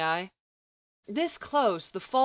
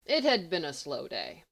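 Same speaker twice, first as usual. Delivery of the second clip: ordinary delivery, nothing marked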